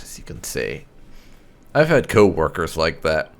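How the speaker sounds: normal talking voice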